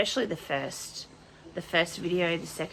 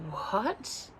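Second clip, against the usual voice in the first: soft, higher-pitched disbelieving "what"; stunned what; 5 semitones higher, 5 dB quieter than usual. Retold or imitated. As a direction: retell a hushed moment of shock. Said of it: "I'm just like".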